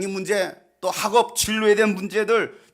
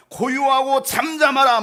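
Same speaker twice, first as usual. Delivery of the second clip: loud, raised command to be still; rebuking command; 7 semitones higher, 6 dB louder than usual. Acted. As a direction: rebuke the storm with force. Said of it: "바람을 꾸짖으시고". (꾸짖듯 크고 높게 명령)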